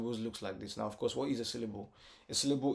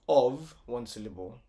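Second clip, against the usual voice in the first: normal talking voice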